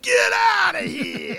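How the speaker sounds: very high, slow, loud yell; wild rapper shout. Retold or imitated. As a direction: holler it like [name]. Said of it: "He's like".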